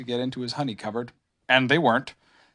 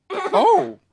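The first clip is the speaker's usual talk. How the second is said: high, louder drawn-out bear voice; badly faked sadness, pitched high; Pooh feigning regret; 6 dB louder than usual. A narrator's reading. Pooh hides relief about the honey, feigned regret. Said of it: "tried to make it sound sad and regretful".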